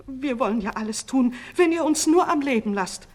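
high, loud, whining plea; wailing. A narrator's reading. Two old sorcerers promising anything, wailing. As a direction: wail a desperate promise to obey. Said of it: "jammerten die beiden Alten".

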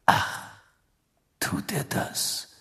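whispered, slow question; suspicious musing. Acted. Sirius reacts to Bagman's offers of help, suspicious whisper. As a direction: breathe it out slowly, wondering.

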